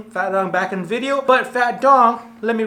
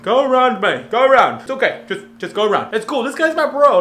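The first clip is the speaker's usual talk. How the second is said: raised, louder voice waving traffic round; calling out; 6 semitones higher, 6 dB louder than usual. Retold or imitated. Man waving cars past his broken-down ride, calling out. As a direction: call to drivers to go around, casually insistent.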